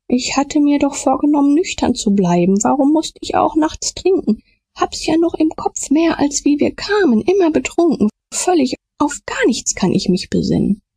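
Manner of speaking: put-on high, frightened drunk man; terrified pleading hostage. A narrator's reading. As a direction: speak high and full of fear. Potter terrified, confused after murder. (hoch, verängstigt, verwirrt)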